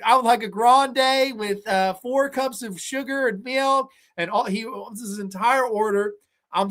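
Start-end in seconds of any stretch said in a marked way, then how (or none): 0.1-3.9 a bit higher, slow, prissy ordering voice; lightly voiced fussy customer; mimicking Will. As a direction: spell out the weird order primly. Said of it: "he said".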